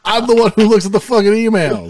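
high-pitched, indignant insistence; exasperated high-pitched outburst; heated protest. Real, not acted. Arguing over the email address.

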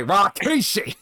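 high, loud commentator's cry; hammed-up wrestling commentary yell. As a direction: holler the name like a shocked ringside announcer. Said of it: "as J R would say".

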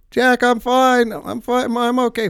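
high, loud, drawn-out holler; imitated strained long-distance shout; retold yelling back. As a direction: holler back insisting all is well.

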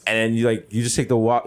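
usual speaking voice

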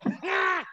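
squealing imitated laugh; high laugh. The Birds still laughing at him, high squealing. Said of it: "they just".

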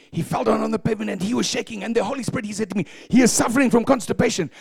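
retold testimony of congregant. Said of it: "she said".

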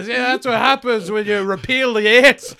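over-the-top desperate performer bit; mock ranting plea. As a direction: beg the crowd in a showy holler. Mock comedian begging audience for gigs.

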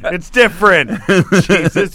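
high, exasperated correction; real loud protest. Real, not acted. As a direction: shout over the laughter to set him straight. Heated objection to co-host's joke.

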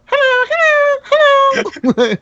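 falsetto squeak on each hello; high squeaky hello voice. Shown as a demonstration. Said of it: "do those voices like".

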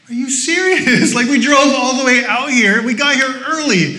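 high, loud mock outrage, laughing; exasperated disbelief. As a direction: throw up your hands at the coffee line. Line out the door after driving.

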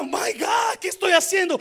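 stricken sinner. Retold or imitated. Preacher acting a churchgoer crying out to God. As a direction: cry out as if caught in sin. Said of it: "en lugar de que diga".

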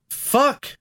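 ordinary delivery

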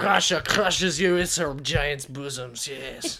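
slow, hammed-up Russian boast; Russian accent. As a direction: play a menacing cartoon Russian, drawn out. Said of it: "Wanna hear Russian?".

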